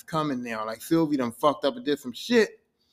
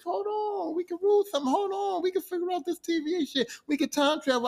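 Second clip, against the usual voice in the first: much higher, frantic begging voice; pleading Loki; 9 semitones higher than usual. Acted. Loki begs Sylvie to stop, high frantic pleading.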